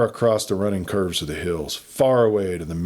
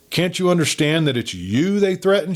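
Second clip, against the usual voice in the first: a raised, pleading outcry; crying out; 8 semitones higher than usual. A narrator's reading. Kate cries out to her father, begging him to understand. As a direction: push the question up high in alarm. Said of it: "she cried".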